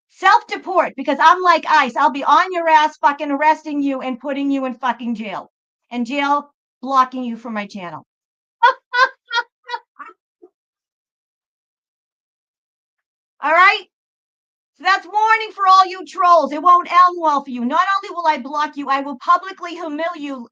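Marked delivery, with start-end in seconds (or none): 0.1-8.1 loud, raised-pitch threat to trolls, half laughing; mock threat. Host warning chat trolls, loud mock menace. 8.6-9.4 high burst of laughter; loud laugh. Real, not acted. Host laughing at her own troll threat, loud laugh. 13.4-19.2 loud, raised-pitch threat to trolls, half laughing; mock threat. Host warning chat trolls, loud mock menace.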